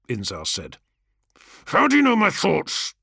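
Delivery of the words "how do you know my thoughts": sharp, high angry snap; snapped. A narrator's reading. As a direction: snap at him in suspicion. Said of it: "Kargos snapped".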